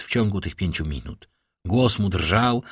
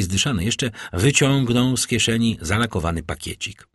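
usual speaking voice

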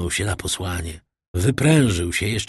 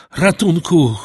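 a loud, high scream of ratunku!; full cry for help; 8 semitones higher, 7 dB louder than usual. A narrator's reading. Stricken priest screams for help as the fit hits. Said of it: "krzyknął ksiądz".

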